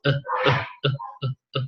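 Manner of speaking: soft repeated "uh" schwa sounds; weak schwa demo. Shown as a demonstration. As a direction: show how weak the schwa is. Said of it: "It's like".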